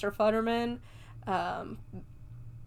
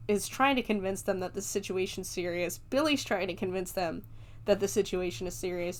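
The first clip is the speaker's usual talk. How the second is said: ordinary delivery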